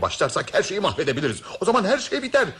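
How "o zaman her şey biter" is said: sudden much higher, louder burst; alarmed warning. Acted. Warning the group everything ends, voice leaping up. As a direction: let the fear of failure spike. Warns the plot will collapse.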